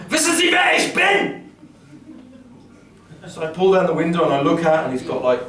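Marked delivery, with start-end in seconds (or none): 0.1-1.4 furious German shout. Retold or imitated. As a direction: yell it like an outraged cop. Policeman shouts again.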